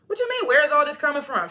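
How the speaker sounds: loud, high, indignant echo; incredulous. Real, not acted. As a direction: throw the question back in loud disbelief. Throws Phil's question back.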